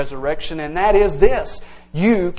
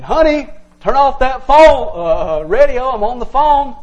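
louder and higher, exasperated parent; worked up; 7 semitones higher, 7 dB louder than usual. Retold or imitated. Parent scolding a kid about the loud radio, worked up. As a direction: snap it like an annoyed parent. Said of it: "You're worked up".

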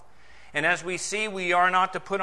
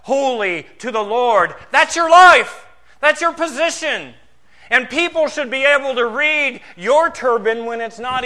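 loud, raised-pitch pulpit proclamation; forceful preaching; 6 semitones higher, 8 dB louder than usual. Real, not acted. Preacher exhorting believers to be holy to the Lord, forceful preaching. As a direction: drive each declaration home to the congregation.

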